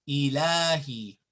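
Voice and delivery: ilahi with a long la; long vowel. Shown as a demonstration. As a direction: hold "laa" in ilahi. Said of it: "I wrote it here".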